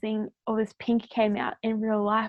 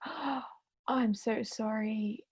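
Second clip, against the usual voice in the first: hushed apology; 7 dB quieter, much slower than usual. Retold or imitated. Gasp before sensing client's loss.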